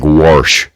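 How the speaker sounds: twangy "warsh", an r slipped in before sh; Midwestern vowel shift. Shown as a demonstration. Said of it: "they also have specific vowel shifts from".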